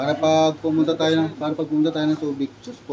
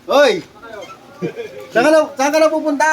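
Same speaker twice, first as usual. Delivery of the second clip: calling from far away; 10 semitones higher, 7 dB louder than usual. Real, not acted. Calling to a driver leaving.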